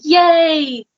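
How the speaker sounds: high, drawn-out celebratory cheer; playful mock hooray; retold cheer. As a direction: cheer as if celebrating a small win. Retold celebration of good sleep.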